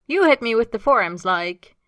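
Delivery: exasperated, loud whine; groaning complaint. Acted. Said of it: "Austin groans".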